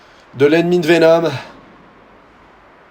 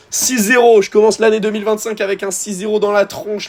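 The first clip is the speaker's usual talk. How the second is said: much higher and louder, disbelief at the score; frustrated high-pitched rant; exasperated outburst; 7 semitones higher, 4 dB louder than usual. Real, not acted. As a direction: cry out the bad news. Losing 6-0, frustrated.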